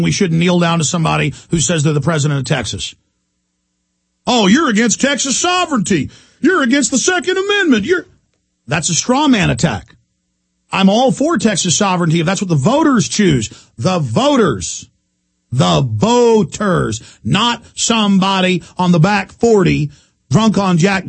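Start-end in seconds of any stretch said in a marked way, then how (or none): 4.3-8.1 high sneering mimic of an accuser; mocking critic. Host mocking his accusers on air, high whiny mimic. 13.8-17.0 slow, weighty repeat of voters; slow emphasis. Real, not acted. Host insisting to listeners, slow pounding emphasis.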